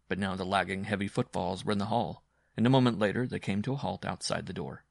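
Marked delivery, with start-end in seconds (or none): none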